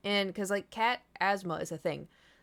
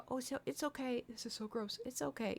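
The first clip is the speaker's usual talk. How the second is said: much softer, gentle reassurance; soothing; 4 semitones higher, 10 dB quieter than usual. Retold or imitated. Comforting her sick cat softly.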